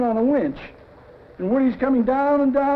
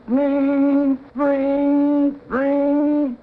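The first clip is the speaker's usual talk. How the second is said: mimicked phone ring; 4 semitones higher, much slower than usual. Storyman acting out the office phone, mock ringing. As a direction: ring it out like a telephone bell.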